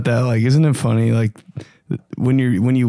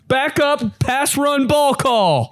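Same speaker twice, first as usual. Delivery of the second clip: high called-out sideline chatter; sideline calls; 13 semitones higher, much slower than usual. Retold or imitated. Sideline players yelling calls to the field, mock high chant. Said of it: "The sidelines, too".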